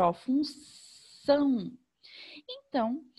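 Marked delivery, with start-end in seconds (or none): 0.0-1.7 very slow, stretched reading of função; teacher spelling out função slowly. Shown as a demonstration. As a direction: sound out função syllable by syllable for the class. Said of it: "a professora vai ler devagarzinho".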